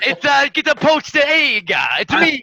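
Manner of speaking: slow, high, hammed-up Italian; Mario Italian accent. Player voices Tank as Mario, slow cartoon Italian. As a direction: go full cartoon plumber, Italian.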